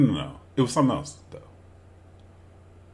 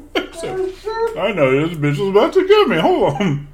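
higher, louder, slow giddy worry; giddy dread; 12 semitones higher, 9 dB louder, slower than usual. Real, not acted. Cohost bracing before trying the twister, giddy. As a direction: brace yourself, half laughing.

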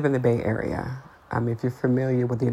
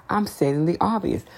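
usual speaking voice

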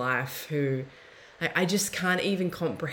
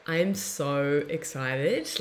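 ordinary talk, nothing marked

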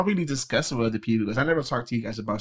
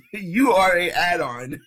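gleeful teasing; 6 semitones higher, 3 dB louder, much slower than usual. Real, not acted. Gleeful teasing, slow and high.

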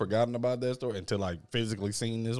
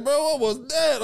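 higher, slower startled roommate; mock startled roommate; 12 semitones higher, 4 dB louder than usual. Roommate asking about the noise through the wall, higher startled voice. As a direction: ask it like you just heard a ghost. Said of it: "My boy was like".

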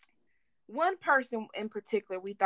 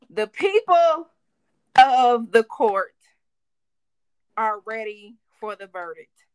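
loud, drawn-out courtroom proclamation; big, booming mock-official announcement; mock court announcement; 10 dB louder, slower than usual. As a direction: proclaim it slowly to the whole court. Mock courtroom announcement of workers quitting.

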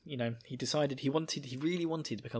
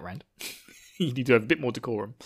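ordinary delivery

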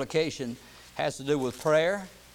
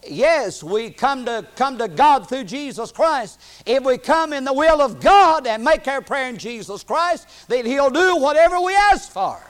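loud, high, ringing pulpit delivery; impassioned preaching; 9 semitones higher, 11 dB louder than usual. Real, not acted. Preacher urging the church to pray in God's will, impassioned preaching. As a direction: hammer the promise home.

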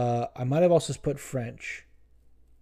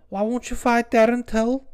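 put-on high, loud Scouse accent; mock Scouse question, high-voiced; heckler on the mic; 10 semitones higher, 5 dB louder than usual. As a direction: imitate an insistent Scouse fan, pitched way up. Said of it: "gets on the mic and he's like".

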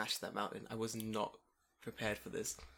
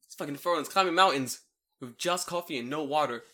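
loud, raised complaint to his wife; hammed-up outrage, louder and higher; mock indignant; 3 semitones higher, 7 dB louder than usual. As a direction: imitate an exasperated husband griping. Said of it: "He's so mad at me".

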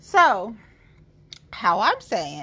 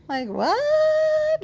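high, incredulous like what; mock shock; 4 dB louder than usual. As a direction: act stunned by your own choice. Mock shock at her own pick.

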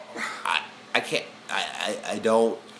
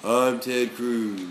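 Ted Cruz impression; 4 semitones lower, much slower than usual. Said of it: "I see Ted Cruz going".

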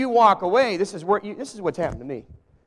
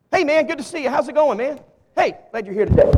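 breezy, slightly raised passing hello; casual greeting; 5 semitones higher, 5 dB louder than usual. Shown as a demonstration. Preacher demonstrating on a volunteer the casual greeting, breezy. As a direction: show the throwaway Southern hello. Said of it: "this is how I'll do it".

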